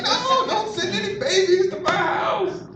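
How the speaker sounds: very high, squealed protest; hammed-up high wail of refusal; mock panicked pleading. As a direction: imitate people fleeing in comic alarm. Acts out frightened people refusing.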